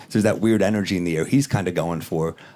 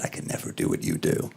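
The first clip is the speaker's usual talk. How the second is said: very low, soft confession; imitating an awed man, low and quiet; hushed admiration; 8 dB quieter than usual. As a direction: say it under your breath, deep down.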